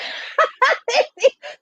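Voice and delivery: high burst of laughter; laughing at her own joke. Real, not acted.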